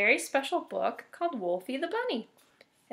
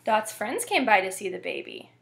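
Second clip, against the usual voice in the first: plain reading voice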